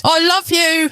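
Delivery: high choking plea; choked out. A narrator's reading. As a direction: force it out through tears. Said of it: "he chokes out".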